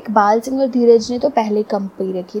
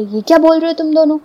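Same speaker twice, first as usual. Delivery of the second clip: fast, higher, louder angry question; angry retort; 6 semitones higher, 4 dB louder, faster than usual. Acted. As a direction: snap back at the two friends in anger. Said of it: "इस बात से मेरे पति को गुस्सा आ गया".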